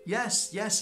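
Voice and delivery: high, loud eager reply; eager yes. Retold or imitated. Eager answer to neighbour.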